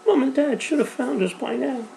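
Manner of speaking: very high, pleading through tears; frightened girl insisting her parents will come, tearful and high; tearful insistence. Acted. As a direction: insist it while crying. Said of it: "she insisted through her sobs".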